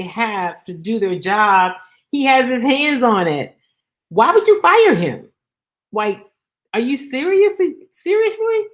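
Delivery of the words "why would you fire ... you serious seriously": real outrage, can't believe it; incredulous. Real, not acted. Host outraged at equipment manager firing.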